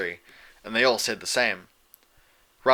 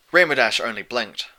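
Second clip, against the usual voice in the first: usual speaking voice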